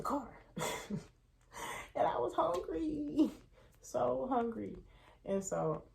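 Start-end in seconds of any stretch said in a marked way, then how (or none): 2.0-4.7 whiny hunger. Real, not acted. Smell of the wings.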